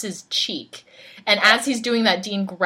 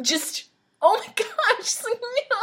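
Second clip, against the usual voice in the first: laughing groan; 13 semitones higher than usual. Real, not acted. Reacting to painful TV scene.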